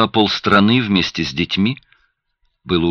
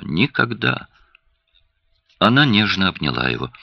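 usual speaking voice

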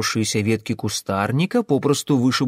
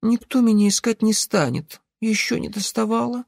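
put-on sleepy child; 10 semitones higher than usual. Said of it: "сонно пробормотала Даринка".

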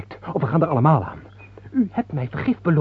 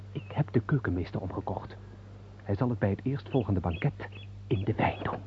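much softer, lower secret aside; conspiratorial hush; 5 semitones lower, 10 dB quieter than usual. Acted. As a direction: confide the poison plot. Conspirator confides plot quietly. (zacht samenzweerderig gefluister)

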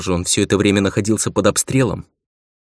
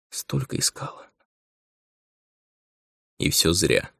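barely voiced, words sticking in the throat; choked whisper; 3 semitones lower, 6 dB quieter than usual. A narrator's reading. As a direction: whisper past a lump in the throat. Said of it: "Слова не проходили через застывший в горле ком". (сдавленным шёпотом)

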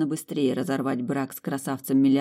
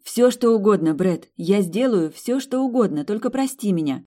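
higher and louder, eager to please; eager pleading; 7 semitones higher, 5 dB louder than usual. Acted. Woman begging her fiancé's forgiveness, eagerly. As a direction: promise everything in a rush. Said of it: "с готовностью пообещала девушка".

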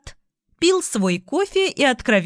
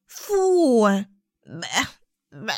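disgusted; much slower than usual. A narrator's reading. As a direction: ham up the yuck. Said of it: "очень красочно начала изображать".

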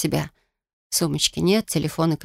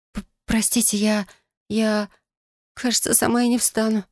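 timid, higher stammering plea; scared stammer; 4 semitones higher than usual. Acted. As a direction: stumble over words in fear. Injured girl stammering to stranger.

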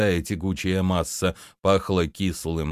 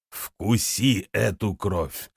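solemn command; slower than usual. A narrator's reading. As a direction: intone it like a dark priest. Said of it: "торжественно произнёс". (торжественно и медленно)

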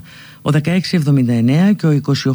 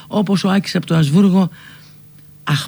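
normal talking voice

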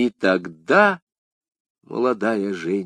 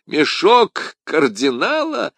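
slow, louder, higher laughing question; 6 semitones higher, 5 dB louder, slower than usual. A narrator's reading. D'Artagnan bursts out laughing at Madame Bonacieux, laughing. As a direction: laugh it out in disbelief. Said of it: "расхохотавшись".

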